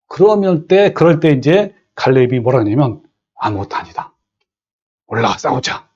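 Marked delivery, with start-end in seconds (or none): 3.3-5.9 낮고 조용하게, 확신에 찬 갈렙 흉내; 겁 없이 단호하게 선언하듯; 갈렙의 담대한 말. Retold or imitated. (낮게 깔린 목소리로 갈렙의 확신을 흉내 내듯)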